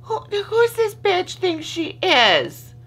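high, slow snotty viewer voice; mock annoyed viewer. Voicing a viewer sick of her whining, high and sneering. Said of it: "and you're like".

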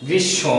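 গ্রীষ্ম said grishshõ, doubled sh, nasal end; গ্রীষ্ম with sh held double and no m; correct pronunciation. Shown as a demonstration. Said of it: "উচ্চারণের জন্য আমাদের গ্রীষ্ম".

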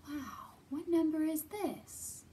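usual speaking voice

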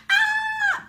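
very high delighted shriek; excited squeal. Real, not acted. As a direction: squeal with glee.

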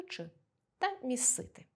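ordinary talk

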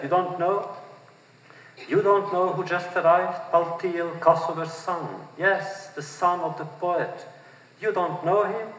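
high, urgent disbelief at the crowd; can't believe they don't know him. A narrator's reading. Narrator scolding the ignorant crowd, pitched high in disbelief.

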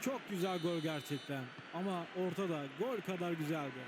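usual speaking voice

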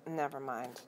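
plain reading voice

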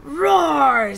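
loud, full roar; triumphant little lion's big roar; proud lion roar. Acted. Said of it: "said Little Lion proudly".

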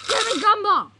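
high, very loud demand; frantic high-pitched yell; desperate shout. Acted. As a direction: yell it, craving a gumball. Desperate after days without gumball.

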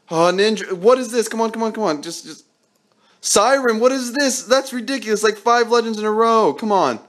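worked up, begging the next roll; frantic disbelief. Real, not acted. Frustrated by repeat legends.

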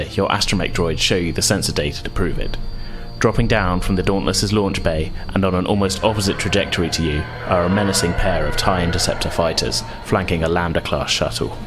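ordinary delivery

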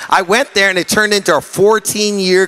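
loud rhythmic preaching; fiery preaching. Real, not acted. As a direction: preach it with full force. Preaching at full force.

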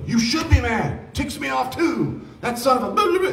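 a hammed-up high friend voice fanning the anger; mock riled-up friend. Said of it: "well-intentioned friends do this".

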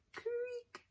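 high thin creak; creaky door voice. Acted. Sound word in story.

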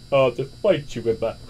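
ordinary talk, nothing marked

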